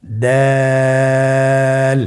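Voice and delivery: দাল with the vowel held three counts; Arabic letter with three alif lengthening; three alif stretch. Shown as a demonstration. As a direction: draw দাল out long and thin. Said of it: "আবার বলুন".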